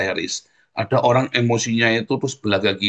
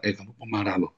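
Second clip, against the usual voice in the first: quieter, gentle warning; soft self-reminder; 8 dB quieter than usual. Retold or imitated. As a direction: say it softly to yourself. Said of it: "kamu bilang".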